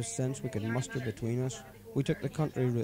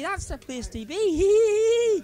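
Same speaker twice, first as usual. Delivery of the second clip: slow, much higher and louder drawn-out cry; howling; 10 dB louder, much slower than usual. Acted. Paddy howling his motto at his friend, loud drawn-out cry. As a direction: let it out as a final howl. Climax of drunk chant.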